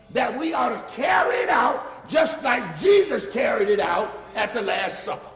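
loud, high, shouted sermon, slow in places; full-force preaching. Acted. Preacher rousing the congregation about communion, full-force preaching. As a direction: preach it out to the back pews.

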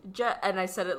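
usual speaking voice

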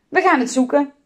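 put-on reply brightly like determined kids; lightly voiced children's call; children calling back. A narrator's reading. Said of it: "riepen de kinderen".

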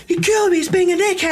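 put-on high, loud complaining kid; whiny angry kid. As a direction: whine it out furiously.

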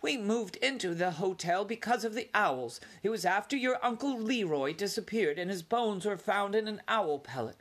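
high, anxious mother remembering loss; lightly voiced frightened mother mouse; fearful mother mouse. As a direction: warn him with a family tragedy. Mother recalls Uncle Leroy.

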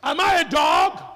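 loud, high mocking challenge; Goliath taunting David, shouted mockery; taunting giant. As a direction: bellow a sneer at the boy. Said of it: "He said".

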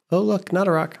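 playful spotting. Pretend. Parent playing at spotting seals.

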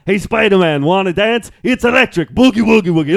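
loud, high, hammed-up Austrian villain voice; Arnold Schwarzenegger. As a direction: belt out the silly pun like an action star. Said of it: "if Arnold Schwarzenegger played Electro".

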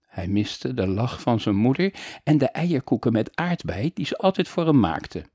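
ordinary delivery

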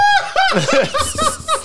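a high, shrieking burst of laughter; screaming laugh. Real, not acted. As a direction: let the laugh explode into a shriek. Story peaks, friend cracks up.

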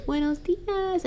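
slow, sing-song morning greeting; grandma's baby greeting. Retold or imitated. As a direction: coo it slowly to the baby. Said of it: "she goes".

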